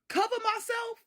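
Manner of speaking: high, loud, incredulous question; indignant echo. Retold or imitated. Narrator answering granny's order to cover up, outraged disbelief. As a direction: throw it back in outraged disbelief.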